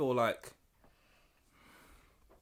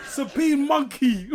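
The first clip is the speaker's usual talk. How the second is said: slow laughing repeat; 6 semitones higher, 4 dB louder, much slower than usual. Real, not acted. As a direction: laugh through the name.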